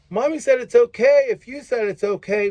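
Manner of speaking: high, loud mock kid wheedling; pleading child. Child playing one parent off the other, high-pitched pleading. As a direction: play a kid pushing for a yes.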